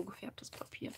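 soft whispered aside; whispering. Real, not acted. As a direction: drop to a whisper. Aside about the dyed paper.

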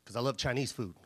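low, soft deadpan dad; dad's voice. Retold or imitated. Dad explaining his marriage to his kid, low deadpan. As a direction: deliver the punchline low and quiet, as dad. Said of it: "He's like".

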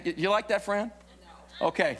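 ordinary delivery

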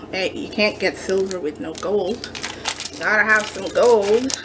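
ordinary talk, nothing marked

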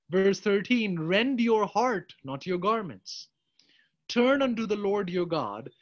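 fervent preacher declaiming scripture; preaching at full force. Real, not acted. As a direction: proclaim the verse like a call to repent.

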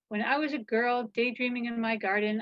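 plain reading voice

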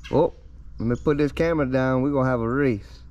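usual speaking voice

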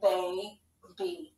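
put-on "Baby" split in two, a gap between the syllables. Shown as a demonstration. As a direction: say "Baby" one syllable at a time. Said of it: "say each syllable".

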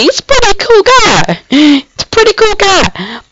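high, put-on mock praise; exaggerated high-pitched sing-out. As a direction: pitch it way up, hammed-up admiration.

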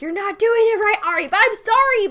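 high, loud mock British accent, telling her off; British scold. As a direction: put on a posh British voice to correct her. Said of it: "every British person watching this is like".

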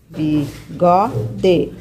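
"bigode" slowly as bi, go, de with pauses; "bigode" sounded out, ending in a full de; syllable by syllable. Shown as a demonstration. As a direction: break "bigode" into three countable pieces. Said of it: "Quantas vezes eu abro a boca".

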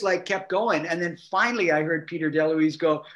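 ordinary talk, nothing marked

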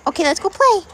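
high, eager play invitation; rally the dogs up high; excited dog talk. Real, not acted.

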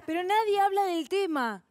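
ordinary delivery

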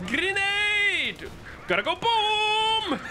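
high excited yell of grenade; grenade warning yell. Real, not acted.

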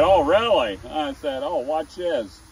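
hammed-up sly challenge; mock skeptical reply. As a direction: raise it like a showman about to prove it. His teasing reply.

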